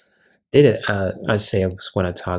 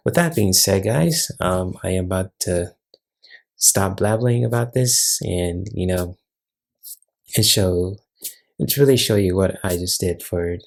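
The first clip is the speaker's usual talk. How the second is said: usual speaking voice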